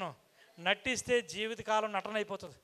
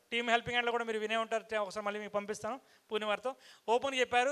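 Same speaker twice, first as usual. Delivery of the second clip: ordinary talk, nothing marked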